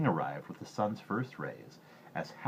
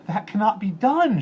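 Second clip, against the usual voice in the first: much higher, loud desperate cry; a frightened girl protesting to the king; 14 semitones higher, 12 dB louder than usual. A narrator's reading. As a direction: cry it out in despair. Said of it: "she cried".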